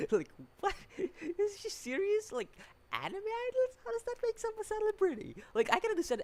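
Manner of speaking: like you can't believe what you heard; incredulous squeak. Real, not acted. Reviewer baffled that anime idols count as celebrities, high incredulous squeak.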